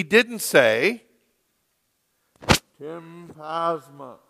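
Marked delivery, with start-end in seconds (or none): 2.8-4.2 slow, soft, otherworldly call; voice in a dream. Retold or imitated. Pastor mocking a divine summons he never got, slow and soft. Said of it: "in a dream".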